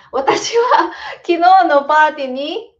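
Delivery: bright quiz-show reading. Real, not acted. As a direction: read the item out with a lift. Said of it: "reading quiz item aloud".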